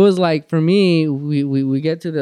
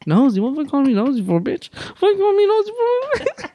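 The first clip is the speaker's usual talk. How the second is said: indignant retort; 10 semitones higher than usual. Retold or imitated. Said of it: "I'm just like".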